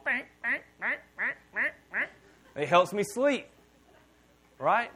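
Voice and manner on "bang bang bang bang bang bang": higher bursts of bang, bang; fan noise imitation. Pastor mimicking a noisy fan, higher. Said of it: "I need a fan going".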